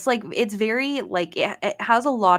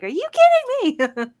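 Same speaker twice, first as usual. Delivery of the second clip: high, loud mock yell at game characters; retold exasperated yell; 7 dB louder than usual. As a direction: re-enact yelling at them, voice shooting up. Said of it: "yelling at the characters".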